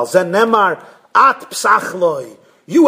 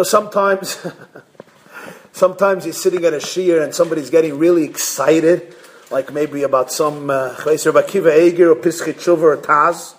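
normal talking voice

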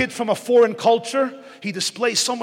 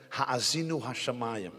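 soft, low, held-back Ha'azinu hashamayim; speaking too low; 10 semitones lower, 9 dB quieter than usual. Shown as a demonstration. Preacher showing how not to address youth, quiet and low. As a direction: murmur "Ha'azinu hashamayim" down, much softer and lower. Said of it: "you're speaking too low".

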